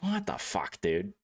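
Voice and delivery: stunned disbelief. Retold or imitated. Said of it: "but I'm just like".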